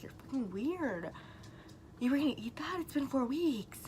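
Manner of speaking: retold arguing. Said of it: "So we, like, argued".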